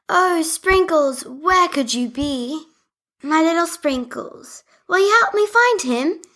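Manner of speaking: high, worried pleading doll voice; Leah pleading. Acted. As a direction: beg for help finding the dog.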